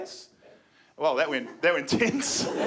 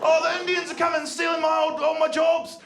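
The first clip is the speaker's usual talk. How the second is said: high, loud whining bigot; high mock complaint; 10 semitones higher, 3 dB louder than usual. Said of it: "Everyone on there's like".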